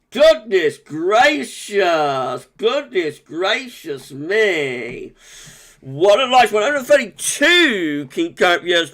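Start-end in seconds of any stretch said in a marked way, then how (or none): 0.1-5.1 drawn-out exclamation. Real, not acted. Stunned by the live stream.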